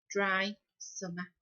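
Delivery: ordinary delivery, nothing marked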